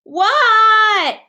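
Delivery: high, loud startled What. Acted. Froggy startled when his friend calls. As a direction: jolt out of a daydream.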